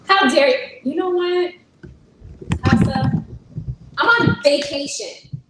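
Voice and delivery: loud, high-pitched indignant outburst. Real, not acted. Streamer answering being called lazy, loudly indignant.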